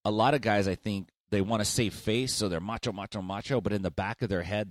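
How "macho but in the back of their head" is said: usual speaking voice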